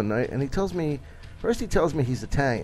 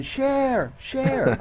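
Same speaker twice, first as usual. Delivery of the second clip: mild mock scream; 10 semitones higher than usual. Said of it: "this one girl screaming".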